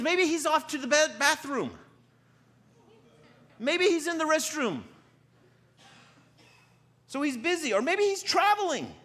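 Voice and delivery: high, loud mocking call; Elijah poking fun. Elijah mocking the prophets about their absent god, yelled taunt. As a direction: taunt them from across the way, pitched right up. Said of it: "he starts to poke fun at him".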